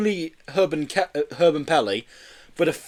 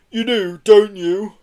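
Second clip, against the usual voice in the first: raised, tentative appeal; hopeful; 6 semitones higher, 6 dB louder, slower than usual. A narrator's reading. Cambo checking the driver knows what she's doing, hopeful. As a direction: seek reassurance. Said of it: "he added hopefully".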